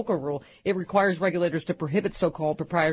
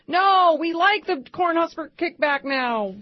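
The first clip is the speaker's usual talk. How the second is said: higher, slow, smug mock; mock politician voice; 10 semitones higher, 5 dB louder, slower than usual. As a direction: play a smug senator, slowly. Mocking Republicans' reversal.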